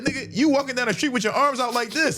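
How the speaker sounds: high-pitched, laughing punchline; retold incredulity. Homie showing him his arms are out, incredulous. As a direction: squeal the reveal in mock disbelief.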